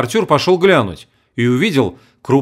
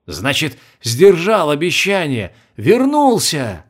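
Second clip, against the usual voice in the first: put-on slow, rising, delighted old man; moved; 3 semitones higher, slower than usual. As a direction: marvel at the return, slowly.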